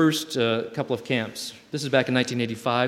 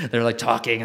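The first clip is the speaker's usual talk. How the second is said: usual speaking voice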